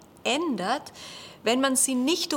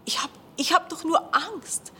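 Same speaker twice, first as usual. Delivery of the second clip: breathy; 8 semitones higher than usual. Acted. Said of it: "hauchte sie".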